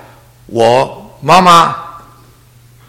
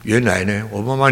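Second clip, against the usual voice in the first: ordinary talk, nothing marked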